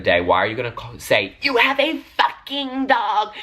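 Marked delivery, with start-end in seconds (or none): 1.4-3.3 slow, high, weird mocking voice; imitating the dog guy who taunted her, slow and high. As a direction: mimic the creepy guy, drawn out high. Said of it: "his head was, like, twitching".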